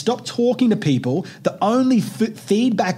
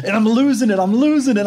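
louder, higher, worked up; mounting rage; 5 semitones higher, 4 dB louder than usual. Retold or imitated. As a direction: relive the rage as it builds. Retelling road rage.

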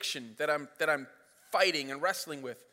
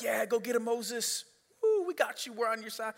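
cheering crowd; 3 semitones higher, 5 dB quieter than usual. Retold or imitated. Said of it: "they're all like".

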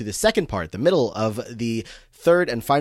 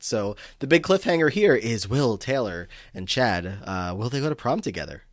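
ordinary talk, nothing marked

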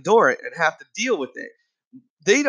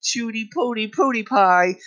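mock-indulgent parent baby talk, pitched up; doting parent; 4 semitones higher, slower than usual. Parent laughing off a child's misbehaviour, doting baby talk. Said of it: "a lot of parents out there that just laugh it off".

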